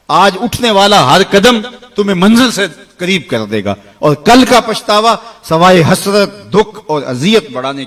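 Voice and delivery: loud, high rousing call; rally the listeners to act now; fiery preaching. Acted. Preaching at full force.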